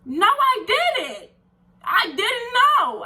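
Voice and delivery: high, slow pleading denial; upset protest. Retold or imitated. To the boss firing her, a high slow plea. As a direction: insist you didn't know, voice jumping up. Protesting being fired unfairly.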